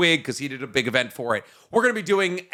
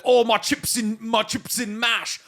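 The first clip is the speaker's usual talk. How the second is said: higher-pitched mock British accent; 6 semitones higher, slower than usual. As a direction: poke fun with a hammed-up British accent. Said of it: "the greatest English accent out of all of them".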